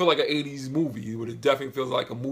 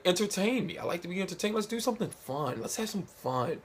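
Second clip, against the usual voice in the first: higher, softer, playful impression; Jeff Goldblum; 5 semitones higher, 5 dB quieter than usual. Grandmaster asking to be entertained, lightly imitated in a higher, softer voice. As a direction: lightly imitate an actor eager to be amused. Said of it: "not do a Jeff Goldblum impression".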